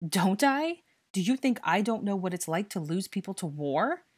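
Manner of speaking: loud, heated challenge starting high; losing control. A narrator's reading. Harry answers the boy about losing people to war, heated. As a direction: challenge him as your temper slips. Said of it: "getting louder and more heated".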